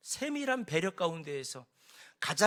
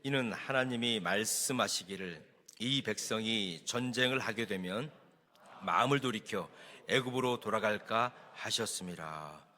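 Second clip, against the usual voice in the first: scripture reading; 8 semitones lower, 3 dB quieter than usual. Acted. Pastor leads congregation reading the verse together, solemn scripture reading. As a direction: read the verse aloud, low and unhurried. Reads scripture aloud in unison.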